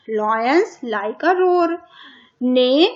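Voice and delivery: drawn out, stressing roar; slow dictation. Shown as a demonstration. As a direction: read it very slowly so kids can follow. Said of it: "horse की आवाज को क्या बोलते हैं?". (धीरे-धीरे, roar पर ज़ोर देकर)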